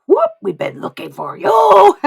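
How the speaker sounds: high, loud whoop of recognition; excited spirits calling. Acted. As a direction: pounce on a long-sought person with a loud cry. Voices spirits spotting the client.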